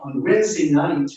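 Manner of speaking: ordinary talk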